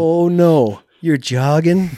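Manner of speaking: high, loud, sneering mock sympathy; scoffing at his own tired legs, loud and high; mocking taunt. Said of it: "I'm like".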